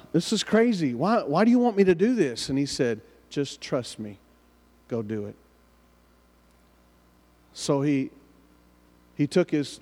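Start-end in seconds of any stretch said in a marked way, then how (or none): 0.1-2.5 higher-pitched mock complaint; incredulous protest. Man questions God's order to hitchhike, acted protest. 3.3-5.4 softer, lower, unhurried voice; calm reassurance. Retold or imitated. The Lord answers the reluctant man, quiet calm command.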